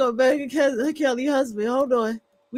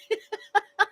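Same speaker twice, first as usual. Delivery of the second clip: high breathy laugh; 7 semitones higher than usual. Real, not acted. Reacting to clip.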